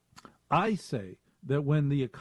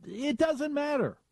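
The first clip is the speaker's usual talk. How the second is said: mock squeaky repeat; 13 semitones higher than usual. Shown as a demonstration.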